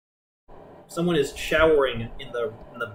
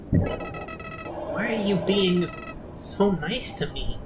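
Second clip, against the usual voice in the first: high, very slow and faltering; bewildered, hurt; 7 semitones higher, much slower than usual. Acted. Villain puzzled by the player's mercy, hurt. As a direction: draw it out in pained disbelief.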